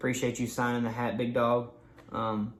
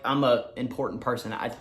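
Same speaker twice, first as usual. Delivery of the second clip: ordinary delivery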